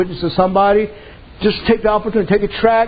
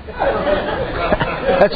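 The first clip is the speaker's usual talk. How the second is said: laughing; 3 semitones higher, 4 dB quieter than usual. Real, not acted. Mocking laugh at weak preachers.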